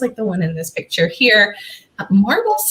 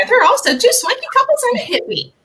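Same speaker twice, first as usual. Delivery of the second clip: excited; 13 semitones higher, faster than usual. Real, not acted. Gleeful teaser about the ghosts.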